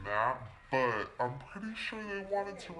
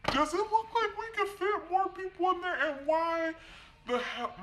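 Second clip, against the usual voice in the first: much higher, incredulous squeal; exasperated disbelief. Retold or imitated. Wife pleading with the cop about the packed car, incredulous. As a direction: pitch it way up in outrage.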